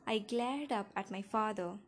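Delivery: usual speaking voice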